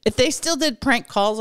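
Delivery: ordinary talk, nothing marked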